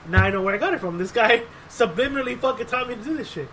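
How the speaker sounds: ordinary talk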